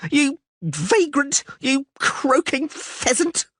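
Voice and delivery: high, slow drawn-out insults; screaming woman. A narrator's reading. Blonde spits insults down at Dandelion, high and slow. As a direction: hurl the names down. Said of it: "the blonde screamed".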